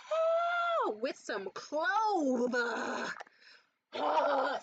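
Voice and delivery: high, drawn-out swooning moan; sudden high swooning oh at the scent; pleasured moan. Real, not acted. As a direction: melt over the smell with a slow moan. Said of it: "sorry, I almost came".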